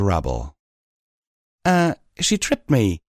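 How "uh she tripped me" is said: high, sheepish excuse; embarrassed little excuse; weak excuse. A narrator's reading. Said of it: "I offered weakly".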